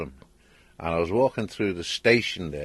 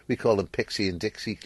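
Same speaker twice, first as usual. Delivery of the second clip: normal talking voice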